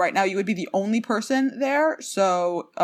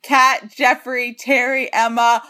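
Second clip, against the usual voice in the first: ordinary talk, nothing marked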